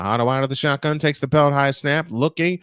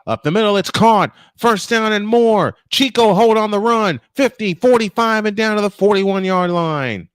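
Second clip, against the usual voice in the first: higher, louder rapid-fire sportscaster excitement; excited play-by-play call; 5 semitones higher, 4 dB louder than usual. Real, not acted. Announcer calls a first-down catch and run, excited. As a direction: call the big catch as it breaks open.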